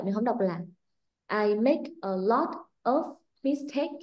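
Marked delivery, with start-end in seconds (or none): 1.5-4.0 exaggerated wrong example; mock rising Vietnamese-style tone. Shown as a demonstration. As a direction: voice pushed higher on "make", slow and choppy. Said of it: "mình không có trớn cái giọng mình cao lên như vậy".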